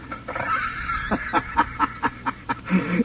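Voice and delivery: quiet, breathy chuckle of satisfaction; soft sly chuckle. Acted. Crook chuckling to himself after hooking the buyer. Villain pleased after sales call.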